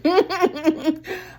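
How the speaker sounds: a short rising self-conscious laugh; embarrassed laugh. Real, not acted. Vlogger laughing at her own rambling, real laugh. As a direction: laugh it off mid-sentence.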